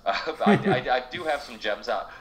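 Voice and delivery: a sheepish laugh through the words. Real, not acted. Laughing at his own early episodes while telling the host. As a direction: chuckle while admitting it.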